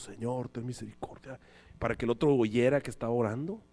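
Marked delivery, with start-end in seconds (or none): 0.0-1.4 low, soft pious prayer voice, mocking; showy prayer. Praying man shows off to the roommate, low pious voice. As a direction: put on a solemn praying tone. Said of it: "levantaba más la voz".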